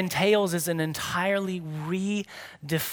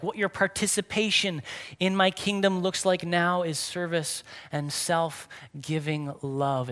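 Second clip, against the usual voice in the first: normal talking voice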